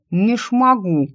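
words through chattering teeth; too frozen to speak clearly. A narrator's reading. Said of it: "у меня зуб на зуб не попадал".

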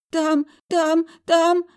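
high, loud hiccuping stammer of terror; girl hiccupping in shock; hiccupping fright. A narrator's reading. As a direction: gasp it out in panic. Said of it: "проикала Параша".

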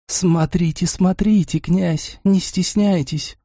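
lightly voiced seductive whisper, slow at the end; sultry coaxing lady, gently voiced; hot whisper. A narrator's reading. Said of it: "услышал я её жаркий шёпот".

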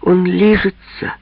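put-on high, slow child's coo; cutesy baby talk. A narrator's reading. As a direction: coo it like a little girl. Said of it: "сюсюкает быстроглазка Верочка".

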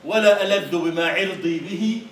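slow, loud proud declaiming; poem recital. Acted. Teacher declaiming Mutanabbi's proud verse, loud and slow. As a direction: proclaim the verse with defiance.

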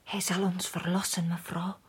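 hushed, secret confession; whisper. Acted. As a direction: breathe it out as a dangerous secret. Servant confides about the prophet.

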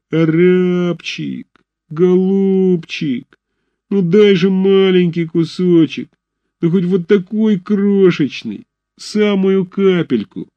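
very slow, high pleading whine; humble beggar voice. A narrator's reading. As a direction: grovel like a street beggar. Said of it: "униженный нищенский голос".

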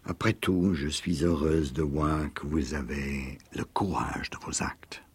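hushed, low-voiced admission. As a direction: say it quietly, almost to herself. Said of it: "d'une voix basse".

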